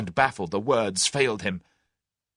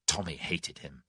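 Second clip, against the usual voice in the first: ordinary delivery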